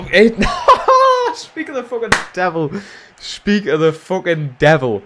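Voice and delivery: high, loud, laughing greeting; real cackling excitement at a friend turning up; delighted outburst. Real, not acted. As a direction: burst out laughing and hail your friend. Delighted as friend joins the chat.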